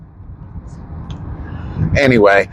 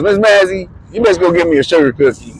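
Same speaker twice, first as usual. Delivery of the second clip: imitating Thurman; 5 semitones higher than usual. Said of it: "Thurman comes in and he goes".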